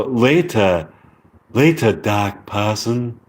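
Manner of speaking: slow British accent practice, Rs dropped. Shown as a demonstration. Repeating the practised goodbye, slow British accent. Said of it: "British accent lesson".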